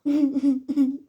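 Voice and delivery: short real laugh; amused laugh. Real, not acted. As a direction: burst out laughing. Watching funny animal clip.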